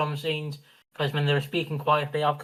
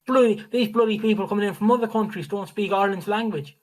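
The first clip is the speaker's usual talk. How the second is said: higher-pitched mock indignant grumble; mock complainer; 6 semitones higher, 3 dB louder than usual. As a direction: imitate a ranting complainer. Mimicking complainers about immigrants in Ireland.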